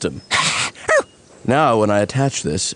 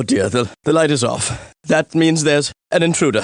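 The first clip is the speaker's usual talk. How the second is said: normal talking voice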